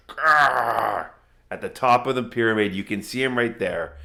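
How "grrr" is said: louder growling roar; ogre growl. Acted. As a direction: growl like a massive ogre charging. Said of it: "this ogre rushes up and says".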